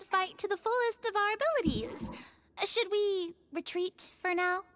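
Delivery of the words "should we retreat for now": normal talking voice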